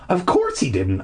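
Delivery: plain reading voice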